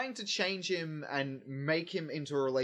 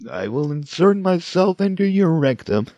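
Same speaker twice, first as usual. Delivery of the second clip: loud menacing; 4 semitones higher, 16 dB louder than usual. Pretend. Mock villain threat.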